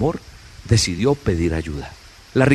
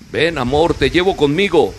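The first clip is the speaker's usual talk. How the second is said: higher, louder call; old man calling Love aboard, higher and louder; kind offer; 7 semitones higher, 5 dB louder than usual. Acted. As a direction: call out to Love from a passing boat.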